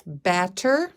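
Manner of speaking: usual speaking voice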